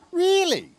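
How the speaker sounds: very high, amused question; chuckling tease. A narrator's reading. James teases muddy Gordon, high and chuckling. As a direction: squeal it in teasing disbelief. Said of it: "chuckled James".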